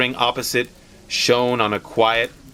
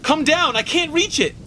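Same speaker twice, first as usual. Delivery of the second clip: much higher, urgent strained calling; panting plea. Acted. Wife calling to husband from the door, mildly straining. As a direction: yell up in panic. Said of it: "she cried loudly".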